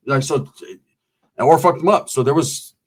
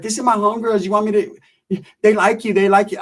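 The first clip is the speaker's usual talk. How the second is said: Sheila; 5 semitones higher than usual. Retold or imitated. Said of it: "She was like".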